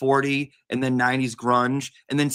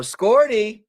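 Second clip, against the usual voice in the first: high doting pet voice; pet coo; 9 semitones higher, 5 dB louder than usual. Real, not acted. Cooing to the pet.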